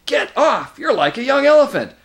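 high, loud, indignant yelling; exasperated, high-pitched complaint; angry protest. A narrator's reading. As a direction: yell at someone squashing you. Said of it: "came an angry voice".

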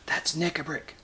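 whisper. A narrator's reading. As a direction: whisper who is speaking. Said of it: "whispered Trumpkin".

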